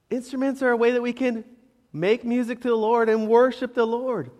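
ordinary delivery

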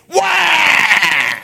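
loud high mock baby wail. Pastor mocking excuse makers with a loud mock wail. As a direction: bawl it like a crybaby.

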